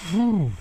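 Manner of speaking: loud, high puff of breath from heavy lifting; effortful exhale. Real, not acted. Lifting a heavy radio unit onto the bench, effortful exhale. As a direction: blow out hard as you heave the weight up.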